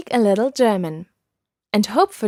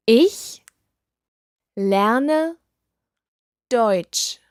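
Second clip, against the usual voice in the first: "Ich", "lerne", "Deutsch" each alone with a long pause between; slow word by word; much slower than usual. Shown as a demonstration. Teacher breaking the phrase down for learners, word by word with pauses. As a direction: spell it out one word at a time for the learner. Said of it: "I'll say it slowly".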